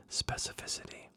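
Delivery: breathy whispered "Specificity". Shown as a demonstration. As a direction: whisper "Specificity" like a secret, no voice at all. Reader savours a favourite word.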